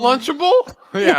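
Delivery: high, loud, laughing through it; laughing guess. Real, not acted. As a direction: crack up while blurting it. Laughing guess at mom's line.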